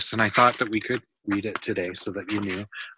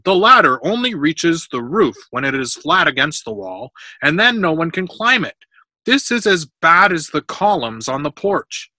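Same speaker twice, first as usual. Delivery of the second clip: raised, higher storybook roar; 6 semitones higher, 8 dB louder than usual. A narrator's reading. Workman roaring at his partner about the ladder, angry. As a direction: argue back loudly and emphatically. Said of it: "Pepros roared".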